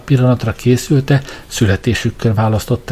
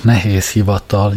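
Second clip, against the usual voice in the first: ordinary delivery, nothing marked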